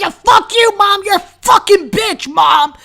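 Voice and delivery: high, loud bratty outburst; yelling at mom. Acted. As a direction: scream it at your mother like an out-of-control kid. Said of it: "fucking yelling at his mom".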